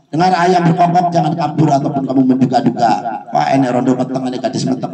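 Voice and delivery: ordinary talk, nothing marked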